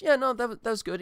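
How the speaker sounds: mock-casual barfly brush-off; casual Norm. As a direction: toss it off as you leave. Norm answering, retold.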